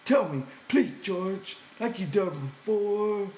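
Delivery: high, begging simple man; pleading. A narrator's reading. Lenny begging George to tell the rabbit story, high pleading. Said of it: "Lenny pleaded".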